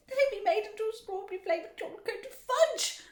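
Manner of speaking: high, loud horrified screech; screaming. A narrator's reading. Mother fearing son becomes fudge, screaming. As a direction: screech it, building to the last word. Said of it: "screamed Missus Gloop".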